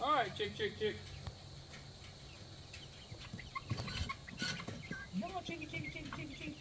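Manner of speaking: high soft coaxing chick call; calling chickens. Real, not acted. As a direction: coax the chicks in sweetly.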